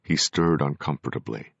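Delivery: ordinary delivery, nothing marked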